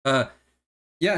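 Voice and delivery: usual speaking voice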